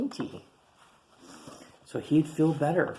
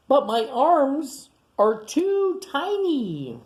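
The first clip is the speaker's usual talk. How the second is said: much higher, louder and slow; high wailing complaint; 12 semitones higher, 6 dB louder, much slower than usual. Acted. Tiny whines to his aunt, high and stretched out.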